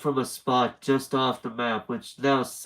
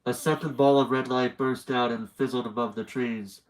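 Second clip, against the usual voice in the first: ordinary delivery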